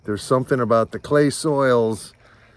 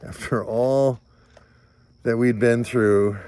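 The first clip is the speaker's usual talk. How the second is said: usual speaking voice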